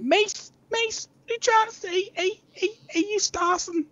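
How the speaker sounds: very high, squeaky mock northern accent; confrontational northern local. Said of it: "And then they're like".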